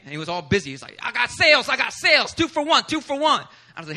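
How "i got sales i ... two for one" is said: higher, louder hawker calling out his sales; street hawker. Retold or imitated. The CD seller on the street, hawking sales. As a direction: hawk the deal like a street seller.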